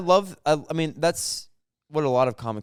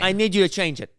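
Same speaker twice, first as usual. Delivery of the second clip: insistent boss; 6 semitones higher than usual. Acted. Boss on zoom.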